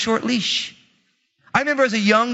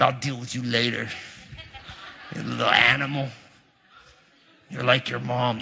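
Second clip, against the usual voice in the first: low, quiet mock-threat to his son; mock menacing dad; 9 semitones lower, 7 dB quieter than usual. Dad jokingly threatening his son, low and quiet. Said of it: "I'm like".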